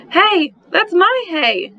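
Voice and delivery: put-on a small kid protesting her hay bed; little girl yelling. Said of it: "Angelina yelled".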